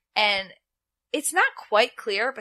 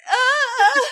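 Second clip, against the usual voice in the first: high loud shriek; 14 semitones higher, 8 dB louder than usual. Retold or imitated. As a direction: let out a scream. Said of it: "And Alicia's like".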